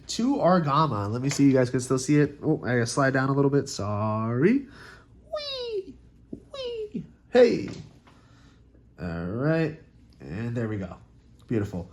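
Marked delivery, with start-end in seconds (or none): 5.3-7.1 high-pitched playful "wee"; playful squeal. Real, not acted. Sliding camera down playfully.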